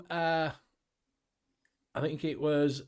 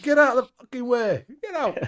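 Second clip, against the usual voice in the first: higher, louder shout to clear the way; mock yell; 7 semitones higher, 7 dB louder than usual. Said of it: "We're like".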